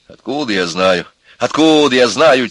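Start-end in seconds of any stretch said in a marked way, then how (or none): 1.4-2.5 higher, louder irritated retort; exasperated repeat. A narrator's reading. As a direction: flare up at the question. Repeats the answer, flaring up. (раздражённый громкий ответ)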